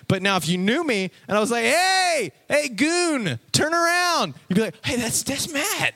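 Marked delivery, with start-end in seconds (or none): 1.6-4.3 high hollered greeting; yelling for someone. Acted. Calling to someone who knows you in the supermarket, yelling. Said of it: "I was yelling for you in the supermarket". 4.8-5.9 high-pitched, slowed realisation; light up at a familiar voice; delighted recognition. Acted. Kid recognising Matt's voice in the supermarket, high and excited.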